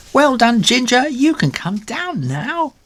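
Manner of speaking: high, loud cheer; high cheering kitten. Acted. Crowd below calls up to Ginger, high cheer. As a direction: call up to the treetop.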